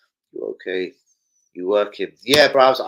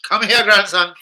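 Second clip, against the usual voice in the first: a hint of a grandad calling his grandson, higher and louder; mock grandad calling; 11 semitones higher, 8 dB louder, slower than usual. Dodging an ex in McDonald's, calling his grandson in a mock grandad voice. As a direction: call out like a pretend doting grandad. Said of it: "I was literally turning the other way like".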